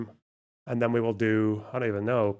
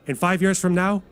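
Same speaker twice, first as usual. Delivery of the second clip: mock TikTok pundit; 6 semitones higher than usual. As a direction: parody a breathless right-wing TikToker in full flow. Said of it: "he probably just looks at other TikToks".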